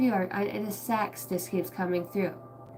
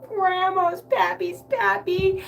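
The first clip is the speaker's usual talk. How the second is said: slow, high, drawn-out mock sobbing whine; hammed-up weepy whine; mock crying; 11 semitones higher, 6 dB louder, much slower than usual. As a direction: wail it like a tipsy crier. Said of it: "you're over there crying".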